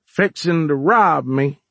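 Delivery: slow, high, folksy drawl, let down; like a father tired of his son's failings; disappointed. A narrator's reading.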